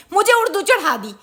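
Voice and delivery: irate mother vowing punishment; fuming. Acted. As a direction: threaten her, half-comic fury.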